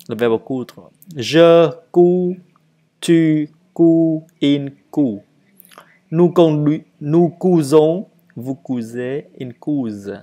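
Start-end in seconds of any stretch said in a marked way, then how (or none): 1.1-10.2 loud, high teacher's model, easing off; firm verb drill, settling at the end; model conjugation. Shown as a demonstration. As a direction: call out each form for learners. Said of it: "à lire et à le dire".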